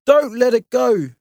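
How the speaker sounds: high, loud urgent shout; Dad yells an urgent order. Acted. Dad urging Mum to keep hold, loud shout. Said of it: "shouted Dad".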